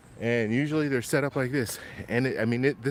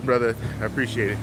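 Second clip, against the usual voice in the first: usual speaking voice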